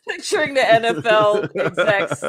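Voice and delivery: talking through laughter; chuckling as you speak; laughing. Real, not acted.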